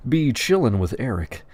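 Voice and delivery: ordinary delivery, nothing marked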